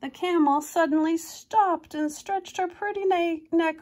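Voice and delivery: lively storybook reading voice; reading a picture book to kids; story narration. A narrator's reading. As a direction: read it like a bedtime picture book.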